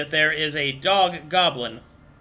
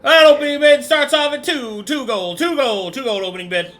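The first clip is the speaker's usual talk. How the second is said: louder, higher auction calling; auctioneer; 6 semitones higher, 7 dB louder than usual. Acted. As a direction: rattle off the bids like an auctioneer. GM voices auctioneer calling bids.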